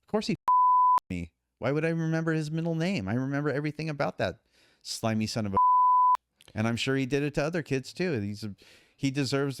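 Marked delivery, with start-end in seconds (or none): 0.4-1.0 sudden high laugh. Real, not acted. Laughs mid-sentence. 5.6-6.2 high laugh. Real, not acted. Laughs instead of insult.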